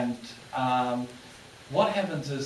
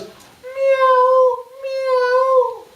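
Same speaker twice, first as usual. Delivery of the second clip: very high, louder meows held long; double cat meow; 9 dB louder than usual. Retold or imitated. Aussie fakes two high cat meows at the guard. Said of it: "And he goes".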